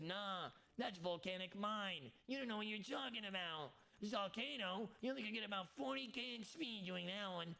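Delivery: mild mock of doubting viewers; mocking chat. Chat doubting his Zalcano claim, mild mocking voice. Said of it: "They said".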